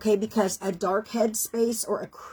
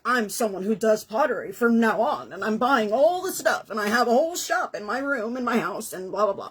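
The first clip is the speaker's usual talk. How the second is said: mock overcommitted hobbyist. Mocking an all-or-nothing hobbyist.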